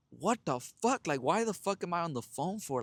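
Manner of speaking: retold exasperation, pitch leaping up; exasperated rant. Venting by text to his brother about her yapping, exasperated.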